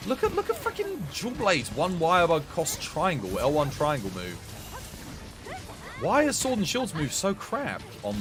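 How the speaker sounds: ordinary delivery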